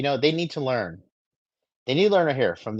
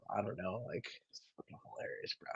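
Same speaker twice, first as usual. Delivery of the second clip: soft, fast unvoiced aside; hushed amused aside; whispered aside; 4 semitones lower, 19 dB quieter, faster than usual. Real, not acted. Laughing aside.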